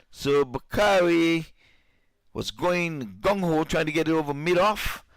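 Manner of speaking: high, loud, rising play-by-play; excited catch call. Real, not acted. Commentator calls a catch as Bakari falls, excited. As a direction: call it high and loud, stretching the big moments.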